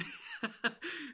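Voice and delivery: a real burst of high laughter; delighted laugh. Real, not acted. Laughing at the voice actor copying his accent. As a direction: laugh out, tickled and amazed.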